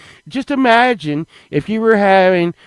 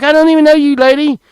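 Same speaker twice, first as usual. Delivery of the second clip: raised protest; 12 semitones higher, 5 dB louder, faster than usual. Retold or imitated. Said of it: "I'm like".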